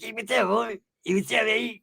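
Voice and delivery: high, muffled little squeak; squeaky voice. Retold or imitated. Mustached homeboy greeting a friend, high muffled squeaky voice. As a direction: squeak it out from behind a big mustache. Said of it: "a squeaky voice".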